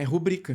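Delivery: "rubrica" stressed on BRI; stress on middle syllable. Shown as a demonstration. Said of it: "A sílaba forte vai cair aqui". ("rubrica" com a sílaba tônica no bri)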